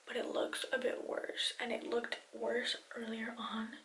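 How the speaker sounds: hushed late-night whisper. Real, not acted. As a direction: whisper so no one wakes up. I'm gonna try to whisper 'cause my mom just went to bed.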